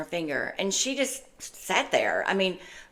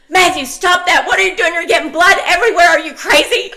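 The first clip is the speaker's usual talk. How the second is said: loud, high mock scolding, fast in places; hollering; 11 semitones higher, 12 dB louder than usual. Mother hollering at her bleeding son over the carpet. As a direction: re-enact a frantic mom yelling. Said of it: "I hollered".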